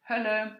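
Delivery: Hölle said with a rounded German ö, as in modern German. Shown as a demonstration. Lecturer contrasts modern German with English hell, careful German ö. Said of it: "closer to English hell than to modern German".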